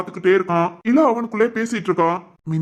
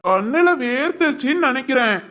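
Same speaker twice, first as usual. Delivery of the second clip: put-on higher, innocent childlike voice; childlike man; 8 semitones higher than usual. Regressed son to his caretakers, higher childlike voice. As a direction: talk like a puzzled ten-year-old.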